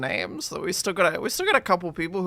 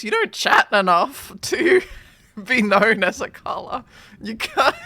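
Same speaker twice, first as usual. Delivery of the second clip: louder, laughing through the words; 5 semitones higher, 7 dB louder than usual. Real, not acted. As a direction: tease while cracking up. Laughing while teasing chatter.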